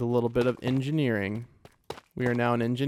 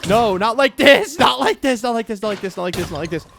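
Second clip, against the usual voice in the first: panicked plea fading; 8 semitones higher, 8 dB louder than usual. Real, not acted. Trapped by mobs in the chamber, panic fading off. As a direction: beg in panic, then lose steam.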